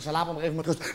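low, soft brush-off; girlfriend's curt reply. Acted. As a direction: say it low and subdued.

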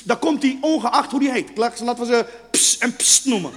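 usual speaking voice

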